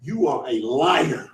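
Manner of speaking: accusing. Retold or imitated. Confronting Byron over the money, low slow accusation. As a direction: drop the voice and call him a liar. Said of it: "walk back to Byron and go".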